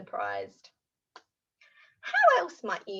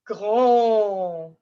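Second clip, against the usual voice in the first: big voice; 2 semitones lower, 7 dB louder than usual. Shown as a demonstration. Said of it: "How about the word for big?".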